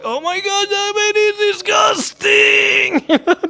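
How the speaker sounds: high, disgusted mock woman's voice. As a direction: squeal it like a horrified shopper. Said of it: "you feel that they're like".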